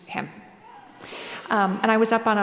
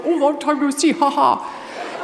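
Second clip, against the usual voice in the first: jokey greeting; 4 semitones higher, 4 dB louder, slower than usual. Retold or imitated. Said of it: "he totally did the".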